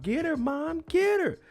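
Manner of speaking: frantic mock squeal. Gia yelling at her mom from the passenger seat, mock squeal. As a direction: squeal it like a panicking kid sister. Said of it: "You hear Gia in the passenger seat talking about".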